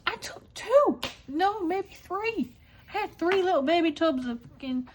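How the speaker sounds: high, rising exclamations of mock disbelief; excited confession. Owning up to the gelato tubs, excited.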